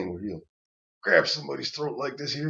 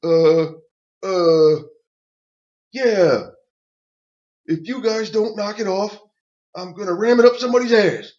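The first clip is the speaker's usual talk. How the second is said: much higher, loud, nervous; shaky shouted threat; 9 semitones higher, 9 dB louder than usual. Retold or imitated. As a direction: bluff a threat, voice cracking. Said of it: "and I said".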